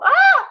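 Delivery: sudden high, loud release cry; emotional cry. Real, not acted. Coach overcome mid-breathing exercise, high loud cry. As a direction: burst out as the feeling overflows. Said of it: "I'm getting emotional".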